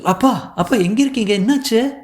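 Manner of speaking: high, anxious questions to father; anxious daughter on the phone; agitated. Acted. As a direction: blurt it out in high-pitched worry. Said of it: "பதட்டு".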